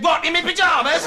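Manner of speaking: high, loud indignant call; calling after someone. Acted. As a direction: shout it down the road after him. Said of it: "so I ran outside and said".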